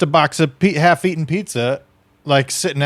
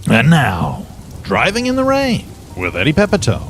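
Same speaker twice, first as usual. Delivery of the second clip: grand announcer intro. Acted. Cohost introducing a sketch, in announcer style. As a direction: announce the new segment.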